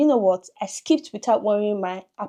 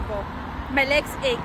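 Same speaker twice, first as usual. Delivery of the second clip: normal talking voice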